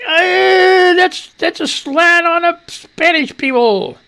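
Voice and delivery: mocking self-righteous critics with a whiny high voice. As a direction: mimic a prissy scold, slow and high. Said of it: "frickin' righteous people say".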